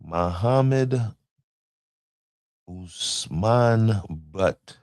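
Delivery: sounding out a name very slowly; slow careful name. Shown as a demonstration. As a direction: spell the name out syllable by syllable. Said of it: "I don't wanna misname you".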